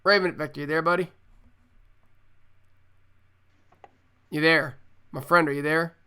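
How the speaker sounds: loud repeated check-in; calling out. Real, not acted. Host loudly calls out to a co-host whose connection dropped. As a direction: call into the silence for him. Co-host's line gone silent.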